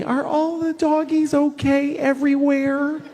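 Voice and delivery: high, slow, sweet worried voice; mocking his younger innocent self; naive young self. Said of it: "When I was, like, twenty".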